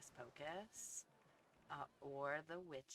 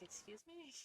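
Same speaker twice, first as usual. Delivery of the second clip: shocked; 12 semitones higher, 4 dB quieter than usual. Retold or imitated. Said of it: "I'd be like".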